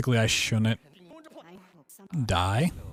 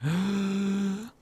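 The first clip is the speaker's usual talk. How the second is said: surprised gasp; 13 semitones higher than usual. Real, not acted. Sudden surprise in game.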